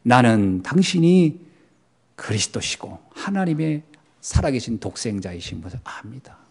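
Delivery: Peter's sincere confession. Acted. Peter confessing his faith to Jesus, hushed and sincere.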